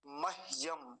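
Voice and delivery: मह्यम carefully as mah-yam; half h. Shown as a demonstration. Teacher asking students to listen closely, half h. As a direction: keep the h in मह्यम vowelless. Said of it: "बड़े ध्यान से सुनेंगे".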